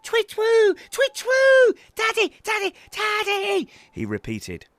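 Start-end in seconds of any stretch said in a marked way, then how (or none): 0.0-3.7 very high, loud owl hoots and calls; shrieking baby owl wake-up; loud hooting. A narrator's reading. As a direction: hoot at full volume to wake Dad. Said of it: "hooted loudly in his face".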